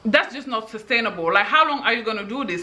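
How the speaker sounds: ordinary delivery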